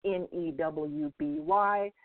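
N E W B Y spelled slowly, letter by letter; spelling it out. Shown as a demonstration. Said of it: "my last name is spelled".

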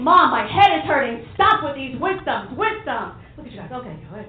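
ordinary delivery

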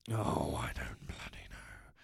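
low unvoiced whisper to himself. Real, not acted. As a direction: breathe it out under your breath. Muttering to himself at screen.

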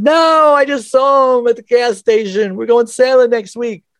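high, loud, cracking cry of denial; stunned disbelief. Retold or imitated. Answering his dad's news about John, high stunned denial. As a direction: blurt it out, refusing to believe it.

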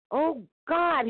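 a high, loud cry of despair; wailing. A narrator's reading. The man wails to God under the wagon's weight. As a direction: wail it up to the sky. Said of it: "he wailed".

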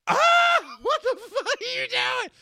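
very high, loud, squealed protest; comic scream. Retold or imitated. Drunk husband screaming as wife brushes his teeth, shrill protest. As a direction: shriek it in shock, way up high. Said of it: "was screaming about it".

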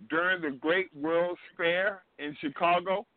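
ordinary talk

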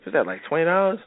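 high, eager mock woman's voice; flirty woman.

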